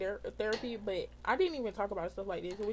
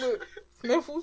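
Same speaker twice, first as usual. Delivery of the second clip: laughing tease; 2 semitones higher, 3 dB louder, slower than usual. Real, not acted. Teasing friend about crying.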